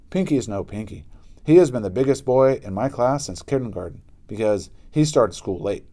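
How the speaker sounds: ordinary delivery, nothing marked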